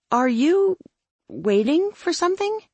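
high, hesitant question; Claire. A narrator's reading. Claire puzzled by Dory, high hesitant voice. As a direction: ask high and puzzled, with a pause.

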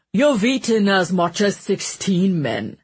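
grumbling northern English butler; northern accent. A narrator's reading. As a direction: complain darkly in a northern accent. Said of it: "he complained darkly in his northern accent".

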